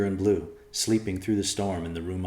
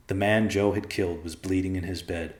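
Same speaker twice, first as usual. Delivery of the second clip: ordinary delivery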